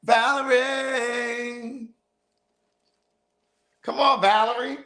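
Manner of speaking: Paris quote. Retold or imitated. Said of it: "Y'all know what this is from. That's from Paris".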